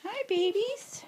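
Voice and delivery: high sing-song puppy greeting. Real, not acted. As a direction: coo at the puppies. Owner greeting puppies.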